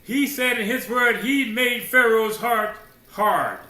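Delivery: loud, high, ringing pulpit declaration; preaching at full force. Real, not acted. Preacher hammering the point to the congregation, full voice. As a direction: drive it home to the congregation.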